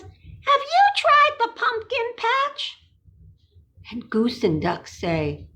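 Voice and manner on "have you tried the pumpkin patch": much higher, slow little creature voice; sing-song Thistle offering help; high critter voice for toddlers. Acted. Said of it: "what Thistle says".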